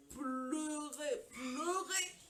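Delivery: each cry word stretched, climbing step by step; drawn-out weeping emphasis. Retold or imitated. Woman recalling endless crying in depression, building to a wail.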